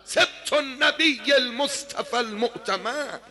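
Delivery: high, loud, slow chanted war boast; a young warrior shouting his lineage to the army; shouted battle cry. Acted. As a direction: declaim it like a hero's rajaz. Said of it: "فریاد زد".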